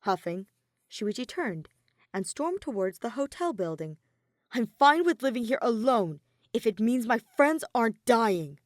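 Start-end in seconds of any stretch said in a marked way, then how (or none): 4.5-8.6 louder, sharp snap; snapped. Acted. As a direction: snap it back while walking off. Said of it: "Shuichi snapped over his shoulder".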